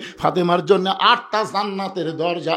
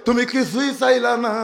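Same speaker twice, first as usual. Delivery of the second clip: high, loud tearful wail; imitated crying lament; 8 semitones higher, 3 dB louder than usual. Ali to his dying wife Fatema, crying lament. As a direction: wail to a wife, easing slightly. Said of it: "Ali কান্দার বলে".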